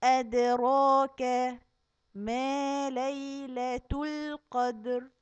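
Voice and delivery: slow drawn-out tajweed chant; Quran recitation. Acted. Teacher reciting the new verses to the kids, slow chant.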